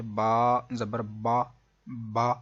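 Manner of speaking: با said with mouth and voice wide open, a clear open "a"; open zabar sound. Shown as a demonstration. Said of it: "آپ کا منہ کھل جائے گا".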